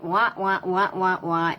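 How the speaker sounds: lightly voiced muffled wah-wah babble; Charlie Brown's teacher. Parent mocks her own lecturing as a muted wah-wah drone. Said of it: "you sound like Charlie Brown's teacher".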